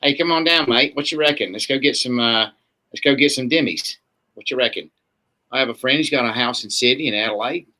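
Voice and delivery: casual put-on Australian accent. Shown as a demonstration. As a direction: play a matey Australian. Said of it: "or if you're from Australia".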